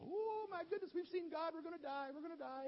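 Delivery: high, panicked wail of fear; panicked cry. Acted. Couple on the ground cries out in high panic. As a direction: cry out in terror, sure you'll die.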